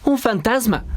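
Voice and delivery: narrator's restrained version of a terrified shout; frightened cry. A narrator's reading. Disciples see someone walking on water, high frightened cry. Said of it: "comenzaron a gritar".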